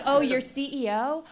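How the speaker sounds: put-on high, loud taunting kid voice; cocky middle schooler taunting his teacher, high and loud; mocking kid.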